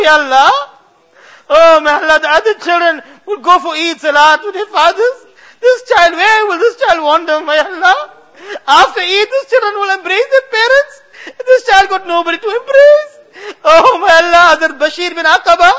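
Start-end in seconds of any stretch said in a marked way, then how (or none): 2.2-13.2 crying, higher, words catching on sobs; sobbing prayer. Real, not acted. Imam sobbing as he describes the orphan child. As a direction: let the tears break the sentences. Weeping over orphan at Eid.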